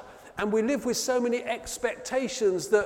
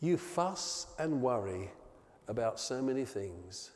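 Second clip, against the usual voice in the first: gentle rebuke; 9 semitones lower, 9 dB quieter than usual. Acted. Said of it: "Jesus looks up at Martha and says".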